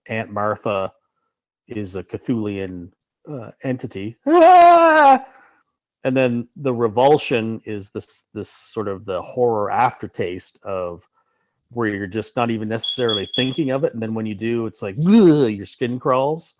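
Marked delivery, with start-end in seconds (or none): 4.3-5.2 high, loud startled scream; mock scream. Monster reveal scream. 15.0-15.5 loud, high grossed-out groan; disgusted ugh. Pretend. Revulsion noise.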